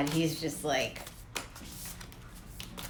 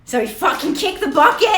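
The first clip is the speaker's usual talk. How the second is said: ordinary talk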